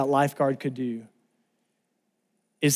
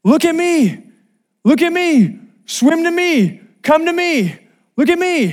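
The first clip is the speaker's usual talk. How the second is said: high, loud lifeguard's shout; urgent yelling; 12 semitones higher, 4 dB louder than usual. Retold or imitated. Lifeguard yelling to a drowning swimmer, urgent shout. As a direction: call out across the water, high and forceful. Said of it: "he keeps yelling to my brother Eric".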